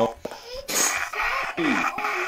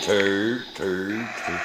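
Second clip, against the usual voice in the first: lower, softer mock ghost voice; mock spirit voice; 7 semitones lower, 8 dB quieter, much slower than usual. Mocking the spirit box voice, low and soft. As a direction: imitate the spirit box saying turd. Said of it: "He said".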